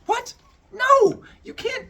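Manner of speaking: very high, loud shocked protest; outraged disbelief. Acted. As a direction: shriek your disbelief at him. Demeter reacts to Zeus.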